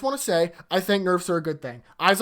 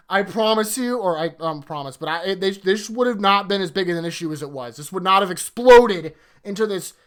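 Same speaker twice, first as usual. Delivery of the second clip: ordinary talk, nothing marked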